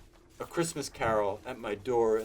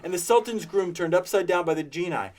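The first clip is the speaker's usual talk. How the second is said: high, loud, gleeful storybook rant; between laughing and crying; 7 semitones higher, 7 dB louder than usual. A narrator's reading. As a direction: relish every figure with giddy glee. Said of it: "in a most extraordinary voice, between laughing and crying".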